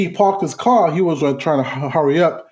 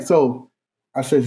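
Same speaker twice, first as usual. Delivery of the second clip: ordinary delivery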